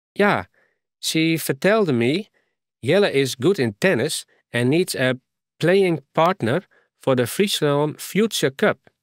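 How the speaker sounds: put-on light English accent in broken Frisian; a foreign girl speaking mixed English and Frisian. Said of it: "Ze praten nogal mooi een accent". (licht Engels accent)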